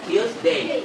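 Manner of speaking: slow "টিউজডে" with a pressed, aspirated T; teacher's slow model of "টিউজডে" with forceful T; hard aspirated T. Shown as a demonstration. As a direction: stretch out "টিউজডে", hitting the T like ঠ. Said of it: "টতে একদম ঠ দিয়ে pressure দিয়ে".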